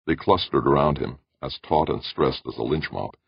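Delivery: ordinary delivery, nothing marked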